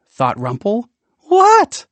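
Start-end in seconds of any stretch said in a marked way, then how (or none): 1.3-1.9 high, loud "oh no"; worried gasp. Acted. Rumpel fears the boy's remark, high loud worry.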